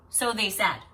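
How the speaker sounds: ordinary delivery, nothing marked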